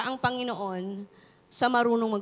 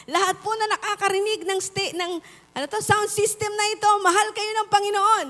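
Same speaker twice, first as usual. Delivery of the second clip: crusade shout; 7 semitones higher than usual. Retold or imitated. Preacher shouting to the crusade crowd over the speakers. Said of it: "sumisigaw ka".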